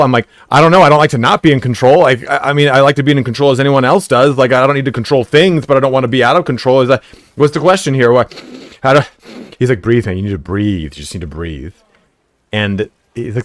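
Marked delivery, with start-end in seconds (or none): 0.5-9.0 higher and louder flustered rambling; babble, flustered and defensive; anxious rambling. Retold or imitated. Panicking guy answering the calm EMT, flustered and anxious. 9.8-11.7 calming coach. Retold or imitated. EMT calming him.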